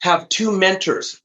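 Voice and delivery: "two" leaned on in a slow line; slow, deliberate "Have two mentors" with weight on "two"; stress on two. Shown as a demonstration. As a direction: hit the word "two" and go slow. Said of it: "The, the two is two mentors".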